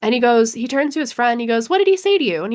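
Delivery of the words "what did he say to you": eager question. Retold or imitated. Rob eagerly asks his friend.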